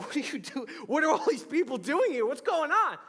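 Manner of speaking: a groom spooked by strangers crashing his party; freaked-out disbelief. Retold or imitated. Groom imagining strangers crash his bachelor party, panicked.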